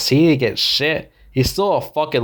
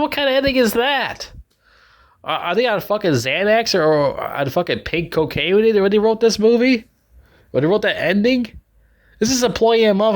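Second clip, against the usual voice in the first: higher, indignant rant; outraged; 7 semitones higher than usual. Real, not acted. Viewer fuming about the writers, indignant. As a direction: pitch up in angry disbelief.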